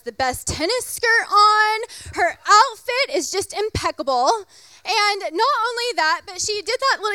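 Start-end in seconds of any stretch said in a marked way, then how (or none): none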